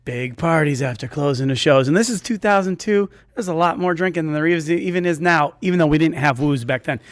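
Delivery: usual speaking voice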